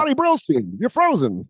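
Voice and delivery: very high pitchman voice; hard sell ad. Retold or imitated. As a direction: overblown TV ad announcer. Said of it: "doing the impression".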